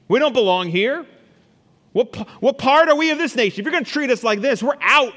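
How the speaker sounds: high, loud indignant protest; defiant outcry. Acted. Preacher voices the tribes, shouting their protest at the king. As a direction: cry out like a wronged people walking away. Angry people rejecting the king.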